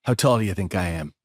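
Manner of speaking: low, quiet challenge; Charles Durning. Retold or imitated. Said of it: "we're standing there and he goes".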